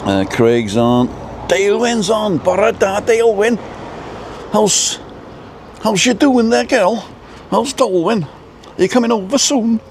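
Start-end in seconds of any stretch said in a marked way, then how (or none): 1.5-9.8 very high-pitched mock Welsh accent; put-on Welsh accent. Greeting Dalewyn on the livestream, put-on Welsh accent. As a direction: put on a squeaky, sing-song Welsh lilt. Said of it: "I gotta do the accent".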